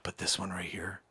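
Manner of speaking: secretive whispered sales pitch; hushed whisper. Acted. Salesman whispering a secret tip to a client on the phone, hushed whisper. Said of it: "I don't want anybody here on the floor to know".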